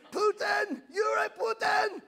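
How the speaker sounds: put-on high shouted kid's taunt; mock shout. Kids shouting at their strict dad, high mock yell. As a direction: yell it up high like a teasing kid. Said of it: "kids call him Putin".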